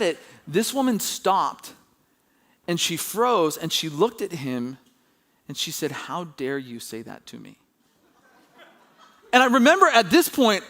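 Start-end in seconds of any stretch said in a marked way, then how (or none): 5.9-7.6 low, slow, hushed affront; quiet indignation. Retold or imitated. Shocked customer answering the clerk, quietly affronted. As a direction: imitate an offended customer, softly and slowly. Retold offended customer, after freezing.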